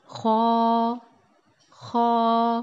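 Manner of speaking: خو from the upper throat, like gargling, lips slightly rounded; Arabic kha sound. Shown as a demonstration. Teacher demonstrates خو for students, throaty gargled kh. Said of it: "ٹھیک ہے؟".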